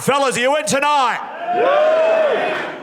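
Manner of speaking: rally the lads like a hype man; hyping the crowd. Real, not acted. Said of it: "I do have a joke for the boys".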